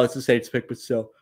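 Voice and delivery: talking through a yawn; stifle a yawn mid-sentence. Real, not acted. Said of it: "I don't know why I'm yawning".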